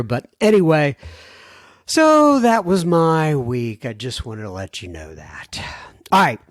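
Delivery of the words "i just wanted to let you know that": hushed, soft whispered aside. Real, not acted. Host whispering an aside to listeners, real whisper. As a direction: drop to a whisper as if sharing a secret.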